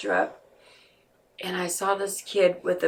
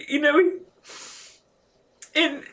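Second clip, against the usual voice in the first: real tears; 5 semitones higher than usual. Real, not acted. Crying while recalling.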